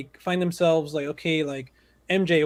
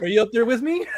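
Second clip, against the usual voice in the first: dreamy astronaut; 5 semitones higher than usual. Retold or imitated. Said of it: "he's like".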